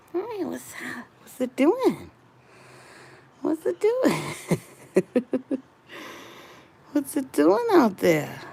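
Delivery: high cooing questions. Real, not acted. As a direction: coo at the cat. Cooing at cat.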